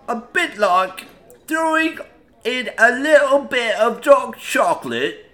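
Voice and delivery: playful put-on Aussie accent; mock Aussie accent. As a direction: read the label like a cheeky Australian, mate. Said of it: "a lot of accents going on in that".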